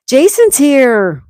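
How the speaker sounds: loud, high, drawn-out announcement; excited call-out. Real, not acted. Streamer greeting a viewer who just arrived, excited call-out. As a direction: sing out the arrival, stretched and loud.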